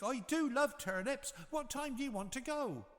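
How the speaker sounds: very high pig voice; a cheerful small pig agreeing; little pig. A narrator's reading. Third pig agreeing to the wolf, high voice. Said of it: "said the little pig".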